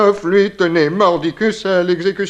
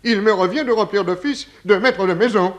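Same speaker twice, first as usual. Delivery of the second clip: usual speaking voice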